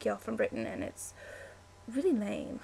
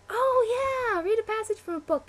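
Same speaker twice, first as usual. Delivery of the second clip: high, loud burst of excitement easing off; light up with real delight, pitch soaring; excited; 10 semitones higher, 4 dB louder than usual. Real, not acted. Reaching the book-reading question on the list, really excited. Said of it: "This is exciting".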